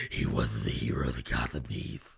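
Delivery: whispered gravelly Batman rasp; mocking the whispery movie Batman; Bale Batman whisper. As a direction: breathe it low like a brooding Batman.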